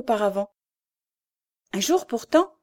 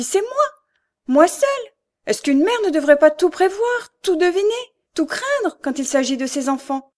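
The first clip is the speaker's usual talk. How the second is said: much higher and louder, anguished; anguished self-blame; 5 semitones higher, 3 dB louder than usual. Acted. Mother blaming herself for her daughter's blindness, anguished. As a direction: cry out her own guilt.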